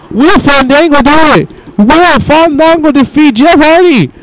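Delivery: frantic call. Real, not acted. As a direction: scream the climax like a ringside announcer. Climactic match commentary.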